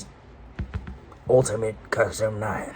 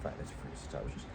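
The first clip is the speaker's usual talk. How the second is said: fast, much softer whispered repeat; whispered aside; 10 semitones lower, 17 dB quieter, much faster than usual. Real, not acted. Kid whispers the phrase again to himself. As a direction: breathe the repeat under your voice.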